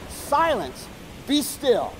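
high, emphatic command falling at the end; commanding rebuke. Retold or imitated. Jesus standing up to the winds and waves, commanding rebuke. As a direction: rebuke the storm with authority. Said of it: "he rebuked the winds and the wave".

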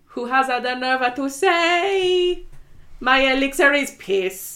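put-on slow, high, hammy Italian accent. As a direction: ham up an Italian showman accent. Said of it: "the Italian accent".

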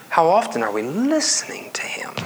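soft voiceless whisper; hushed searching question. Real, not acted. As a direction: ask it in a whisper. Preacher whispers rhetorical question.

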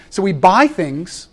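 ordinary talk, nothing marked

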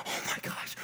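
hushed, whispered amazement; awestruck whisper. Retold or imitated. Preacher overwhelmed by good news, awestruck whisper.

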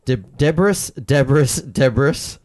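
"Debris" said as tebbers, stressed and rising; debris as they said it. Retold or imitated. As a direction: say "Debris" like the team radio did. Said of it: "I'm just saying it how they said it".

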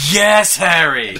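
loud, slow, high mock cheer; excited cheer. Praising friend's Harry impression: loud cheer. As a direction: cheer like an eager fan.

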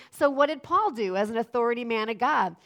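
ordinary talk, nothing marked

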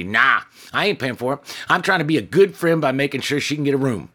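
high, loud indignant insistence; worked up. Acted. Woman refusing to pay, indignant with the manager. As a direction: insist you're the good friend here.

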